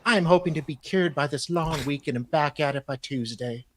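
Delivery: usual speaking voice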